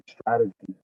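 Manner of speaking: ordinary talk, nothing marked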